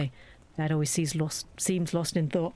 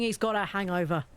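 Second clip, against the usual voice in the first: ordinary talk, nothing marked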